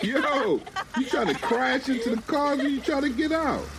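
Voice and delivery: high, laughing alarm; startled laughing yell. Acted. Men startled by the car lurching back, laughing alarm.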